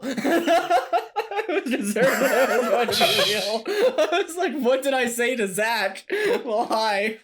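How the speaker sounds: high, laughing through the words. Real, not acted. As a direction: crack up while talking. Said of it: "laughing hard".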